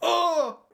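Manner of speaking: short shrill tryout yell; test scream for the phone. Pretend. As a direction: let out a trial shriek as if for the phone call.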